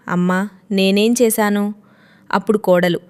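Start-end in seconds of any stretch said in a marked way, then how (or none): none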